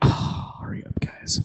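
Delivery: sighing whisper. Real, not acted. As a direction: breathe it out impatiently. Impatient sigh while waiting.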